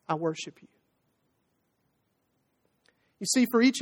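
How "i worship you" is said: soft, low reverent words; hushed worship. Acted. Preacher voicing the believer's response at the cross, hushed worship.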